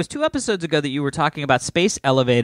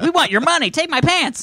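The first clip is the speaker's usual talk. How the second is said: much higher mock pleading; pushy ads; 11 semitones higher than usual. Host imitating pop-up ads, high mock voice. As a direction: squeal it like an obnoxious ad. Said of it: "And they're like".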